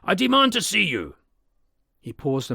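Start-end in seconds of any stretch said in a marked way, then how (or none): 0.0-1.2 high, loud, firm demand. Acted. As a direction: insist through the locked door. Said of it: "cried Utterson with a loud voice".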